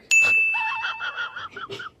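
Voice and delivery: a short, high burst of laughter. Retold or imitated. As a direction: let out a high squeal of a laugh. Friend laughing retold.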